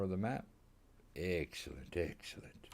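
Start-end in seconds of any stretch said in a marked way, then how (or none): 2.0-2.7 breathy whispered echo. Shown as a demonstration. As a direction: whisper the word excellent. Repeats word, second whispered.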